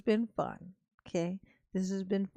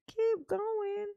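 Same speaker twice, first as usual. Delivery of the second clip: higher plea; 11 semitones higher, 4 dB quieter than usual. Real, not acted. Repeating request higher.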